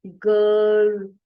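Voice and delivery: गर्ल with its vowel stretched long, said loud and very slow; lengthened vowel. Shown as a demonstration. Teacher stretching the vowel in गर्ल to show its length. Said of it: "जैसे हम आई की sound को लंबा करते हैं ना".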